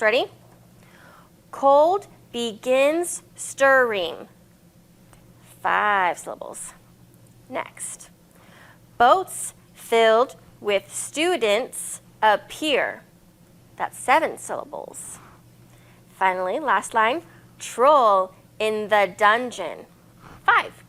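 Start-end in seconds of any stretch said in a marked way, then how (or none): 1.5-4.3 slow, each syllable of cold begins stirring separated; syllables counted out. Shown as a demonstration. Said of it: "clap or you can use your hands". 9.0-13.0 slow, each syllable of boats filled with students appear separated; syllables counted out. Shown as a demonstration. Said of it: "use your hands to count the syllables". 17.6-19.9 slow, each syllable of troll in the dungeon separated; syllables counted out. Shown as a demonstration. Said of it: "count the syllables".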